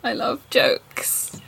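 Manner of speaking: slow whispered fake delight; mock whisper. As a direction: breathe it out slowly.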